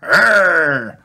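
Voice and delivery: loud, high happy growl; toddler roar. A narrator's reading. Little brother answering sister, playful high roar. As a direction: roar back like a dinosaur. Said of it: "replies George".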